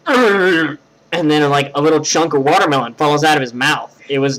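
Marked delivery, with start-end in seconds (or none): 0.1-0.8 raised guttural "urgh"; imitated grunt. Storyteller imitating friend coming up from watermelon, guttural grunt. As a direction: imitate a messy mouthful grunt. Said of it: "And he just goes".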